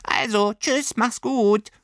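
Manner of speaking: very high, slow creature farewell; fond little creature. Acted. Creature wishing Lilli well before leaving, high and slow. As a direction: wish her well like a tiny friend.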